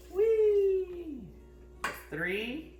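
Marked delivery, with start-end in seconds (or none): 0.1-1.2 excited whee. Real, not acted. Child pouring ingredients, playful cheer.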